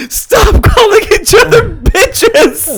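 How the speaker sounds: very high, drawn-out mock wail; exasperated mom. Mom scolding her bickering kids, mock exasperated shriek. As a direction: imitate a mom at her wits' end. Retold mom stopping siblings' name-calling.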